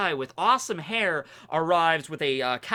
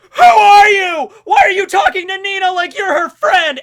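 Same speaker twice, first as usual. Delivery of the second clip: high-pitched, very loud acted shriek; jealous outburst; 15 dB louder than usual. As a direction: explode at a rival in mock fury. Macy jealously confronts Noelle.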